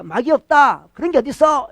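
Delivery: mock atheist's brash dismissal; dismissive atheist. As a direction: scoff it off like an unbeliever. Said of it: "이렇게 하는 무신론자처럼".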